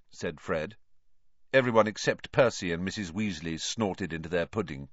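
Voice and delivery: ordinary delivery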